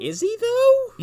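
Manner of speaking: mock doubt, pitched very high; high doubtful question. Real, not acted. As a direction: squeak out the doubt.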